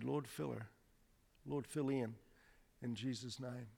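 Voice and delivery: soft murmured prayer; quiet prayer. Real, not acted. Preacher praying over the woman, soft and murmured. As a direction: pray softly under the breath.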